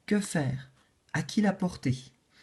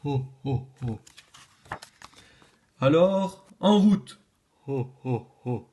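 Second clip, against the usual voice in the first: Father Christmas; 3 semitones lower than usual. Acted. Santa laughs and sets off.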